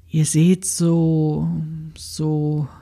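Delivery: slow, halting amazement; lost for words. A narrator's reading. Mimi stares at the old women's dresses, slow and awed. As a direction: search for words in awe. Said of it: "Mimi versuchte ihr Erstaunen in Worte zu fassen".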